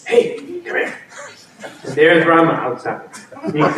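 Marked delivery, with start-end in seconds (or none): 0.1-0.9 breathless whisper from the side, high and laughing; whispered call. Retold or imitated. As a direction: sneak a call to him. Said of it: "he hears a noise from the side, like".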